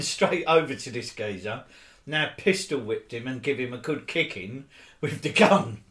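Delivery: usual speaking voice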